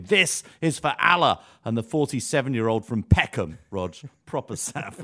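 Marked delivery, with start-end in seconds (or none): 0.0-1.4 mock attacker's shout, higher and louder; retold battle shout. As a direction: shout it like a retold war cry. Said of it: "attackers burst in shouting".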